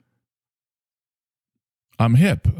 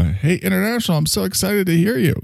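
much higher, sing-song fake cheer; mock chipper; 12 semitones higher than usual. Listener greeting the host with mock chipper cheer. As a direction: gush like an eager fan. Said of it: "show up all chipper going".

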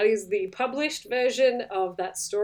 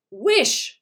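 high, loud insistent order; forceful command; 8 semitones higher, 7 dB louder than usual. A narrator's reading. Wife repeats her forceful command.

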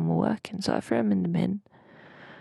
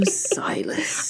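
teasing whisper; 9 semitones higher than usual. Acted.

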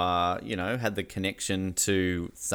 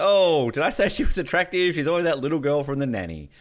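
disturbed; 5 semitones higher than usual. Retold or imitated. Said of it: "you're kind of disturbed that you're like".

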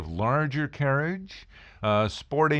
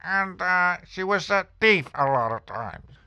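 landlady's voice; 8 semitones higher than usual. Retold or imitated. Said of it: "she kinda had a voice like this".

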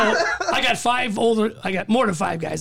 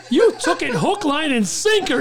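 high, raised-voice retold gloating; imitated captain's amused triumph; gleeful gotcha; 8 semitones higher, 5 dB louder, slower than usual. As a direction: crow over a prank that worked. Captain gloats over his prank.

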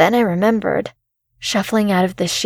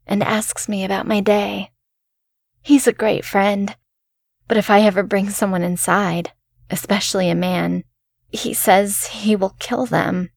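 ordinary delivery, nothing marked

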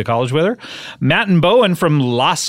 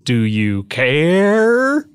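slow drawn-out; much slower than usual. Pretend. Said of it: "repeated mock question, drawn out".

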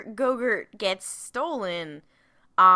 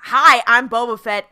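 louder hammed-up introduction; mock announcement; 3 semitones higher, 9 dB louder than usual. Boba introducing himself to Din, loud mock greeting. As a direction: announce yourself loudly and cheerily.

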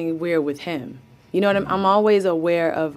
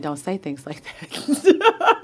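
slow, laughing mock reproach; laughing scold; slower than usual. Mother telling her son off, laughing slowly. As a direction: scold gently while cracking up.

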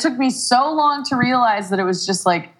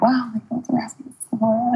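fast breathy whisper, fluttering with delight; flattered ghost girl; 3 semitones higher, faster than usual. Retold or imitated. Ghost girl whispering, flattered that the boys asked how she died. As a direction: gush it out under your breath like she's blushing.

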